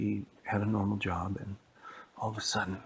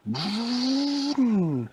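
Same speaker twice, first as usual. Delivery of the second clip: car vroom; 8 dB louder than usual. Retold or imitated. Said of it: "she was like".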